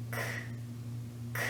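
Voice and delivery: bare voiceless "k", no vowel after it; isolated K sound. Shown as a demonstration. Teacher with a hand on the throat, isolated voiceless "k". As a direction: click out "k" with breath only. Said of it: "there's no vibration".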